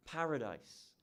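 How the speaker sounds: ordinary talk, nothing marked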